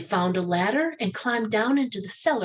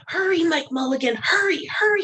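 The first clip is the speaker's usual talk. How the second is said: put-on high urgent child's shout; 10 semitones higher, 4 dB louder than usual. Little boy shouting to Mike Mulligan to hurry, high and urgent. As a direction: hurry him on, rising higher. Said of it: "shouted the little boy".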